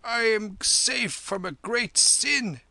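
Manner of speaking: high, loud and slow; stammered shock. A narrator's reading. Hillman halted as though struck to stone, shaken stammer. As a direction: blurt it high, like one badly shaken. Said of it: "he stammered".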